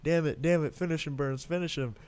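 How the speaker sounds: high-pitched excited fan cheering a fight; hyped. Retold or imitated. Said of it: "I was hype".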